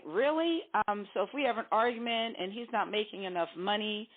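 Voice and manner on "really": high, sharply rising disbelief; incredulous. Acted. Sitting there with your pastor, incredulous. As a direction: question it as if it can't be true. Imagined reaction before the pastor.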